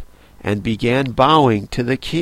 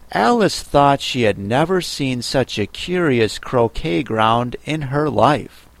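ordinary delivery, nothing marked

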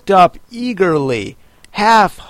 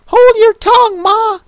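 put-on much higher, louder snappy young crab; snappish young crab; 7 dB louder than usual. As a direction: snap at your mother. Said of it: "a little snappishly".

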